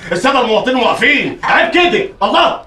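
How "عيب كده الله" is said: scolding. Acted. Scolding the singer.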